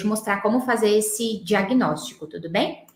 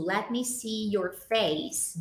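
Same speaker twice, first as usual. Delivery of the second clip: "Let me see your face" slowly and softer; slow, soft model; 7 dB quieter, much slower than usual. Shown as a demonstration. Teacher reads a student's chat example slowly and softly. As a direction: read the student's example gently. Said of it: "Vanessa trouxe outro".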